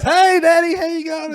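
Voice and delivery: put-on very high, loud child's greeting; Jake. Son in a sequin dress greeting his father, high and excited. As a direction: imitate an excited kid calling out to Dad. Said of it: "here's Jake walks out the front of the house".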